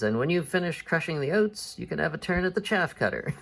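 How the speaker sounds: lightly voiced farmer with a laugh creeping in; farmer giving orders. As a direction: hand out the next chore, amused. Farmer friend gives the next chore.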